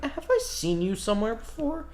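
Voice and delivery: high-pitched, probing question; suspicious questioning. Acted. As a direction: squint through the voice, pitch climbing. Shali peers suspiciously at Alston.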